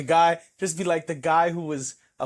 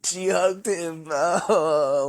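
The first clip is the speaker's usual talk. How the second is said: slow, high tearful wail; hammed-up crying, words stretched out; mock weeping; 3 semitones higher, much slower than usual. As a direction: blubber in exaggerated heartbreak. Mock-weeping over sad films.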